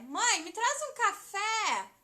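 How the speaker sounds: loud, slow call to mom, fé stretched. Shown as a demonstration. Teacher acting a child calling mom for coffee, loud. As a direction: holler it down the hall. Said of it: "vamos gritar café?".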